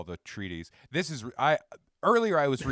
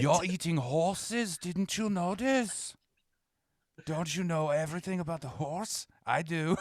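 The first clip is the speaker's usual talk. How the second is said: Belgian Ronald McDonald; 3 semitones higher than usual. Acted. Mascot reveals the horse meat to diners, evil mock-German accent. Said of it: "But you sounded a little evil".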